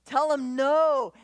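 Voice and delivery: ordinary talk, nothing marked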